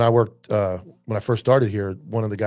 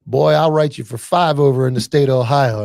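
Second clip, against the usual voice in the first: a hammed-up trooper, louder and higher; mock stern trooper; 4 semitones higher, 7 dB louder than usual. Trooper threatening the speeding driver, louder mock stern voice. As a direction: play the trooper laying down the law, pushed up loud.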